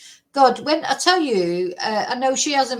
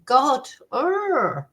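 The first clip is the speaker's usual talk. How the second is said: frustrated groan; 3 semitones higher than usual. Real, not acted. Vlogger fed up with the lawyer, groaning. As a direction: groan out the anger. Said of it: "before I go totally apeshit".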